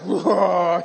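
loud mock roar; imitated gladiator roar. Imitating Ice facing down a challenger, mock roar. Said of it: "This lady, she's like".

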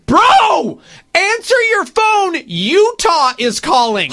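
high, loud, drawn-out plea; exasperated agent. Acted. Imitating an agent urging his player to pick up, exasperated. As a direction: stretch each phrase as if urging a clueless client. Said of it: "his agent calling him and saying".

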